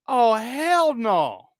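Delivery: slow, drawn-out hell no; drawn-out refusal after hearing about the overdose; emphatic refusal. Retold or imitated. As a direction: stretch it out, firmly refusing.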